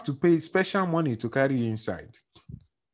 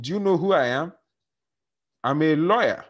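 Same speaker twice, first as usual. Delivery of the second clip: boasting; 5 semitones higher, 4 dB louder than usual. Retold or imitated. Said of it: "but he's already boasting".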